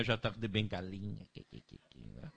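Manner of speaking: lightly voiced feeble old man, low and creaky; mock the old grandpa God; doddering old man. Preacher mocking a weak grandpa God, lightly voiced doddering.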